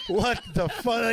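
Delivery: high, drawn-out disbelief with a laugh; mock disbelief. Rookie reacts to teammate's advice, high mock disbelief. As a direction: stretch it out, high and incredulous.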